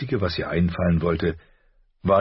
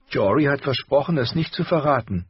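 put-on guilty girl; 10 semitones higher than usual. A narrator's reading. Arya voiced by narrator.

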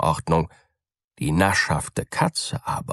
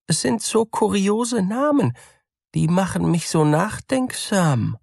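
high, musing mouse voice; wondering mouse; 13 semitones higher than usual. A narrator's reading. Mouse musing on the names, high and wondering. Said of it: "antwortete die Maus".

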